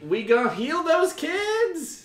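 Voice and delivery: loud, high excited cheer; excited shout. Real, not acted. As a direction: hype the crowd up. Excited donation hype.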